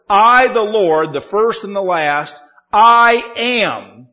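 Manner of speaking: slow, loud scripture quote with weight on "I am"; dramatic, emphatic declaration. Acted. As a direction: draw out "I am" as a solemn claim. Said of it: "dramatic and emphatic statement".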